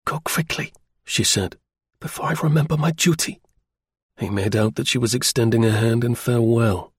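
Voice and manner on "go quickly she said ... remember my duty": woman urging the spy to go, quickly; woman's voice. A narrator's reading. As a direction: send him off before she changes her mind. Woman dismissing him.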